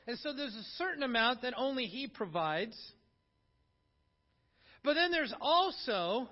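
loud, high, ringing sermon delivery; full-force preaching. Acted. Pastor urging the congregation to comfort others, preaching loud. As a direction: preach it out to the whole church.

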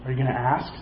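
soft, low questioning nudge; patient waiting. Acted. God waiting to be asked, quiet and patient. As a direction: ask gently and low, rising at the end. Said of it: "sitting there waiting like".

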